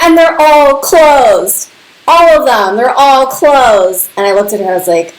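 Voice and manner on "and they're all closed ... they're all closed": high, slow, louder amazement; stunned disbelief. Retold or imitated. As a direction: stretch each word out in shock. Mom stunned, cabinets closed.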